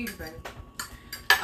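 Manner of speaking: usual speaking voice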